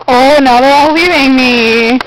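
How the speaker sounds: normal talking voice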